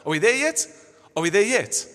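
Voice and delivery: put-on whiny kid asking from the back seat; pestering child. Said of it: "sounds like kids on a trip".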